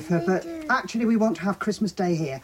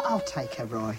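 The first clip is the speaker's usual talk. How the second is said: ordinary talk, nothing marked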